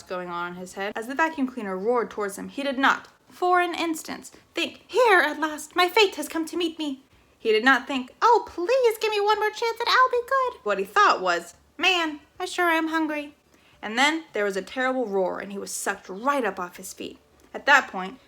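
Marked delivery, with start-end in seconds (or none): 4.9-7.0 squirrel voice. Acted. Squirrel's imagined thought. 8.2-10.6 high, loud pleading creature; pleading squirrel. Acted. 11.8-13.3 squirrel voice. Acted. Squirrel thought.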